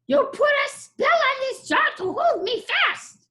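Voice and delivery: high, loud squeaky complaint; a trapped goblin crying foul; goblin protest. Acted.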